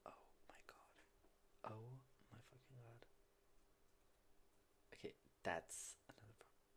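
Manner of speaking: hushed, breathless oh my god whispered; shocked whisper. Real, not acted. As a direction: whisper it, stunned by what you see. Shocked watching own video.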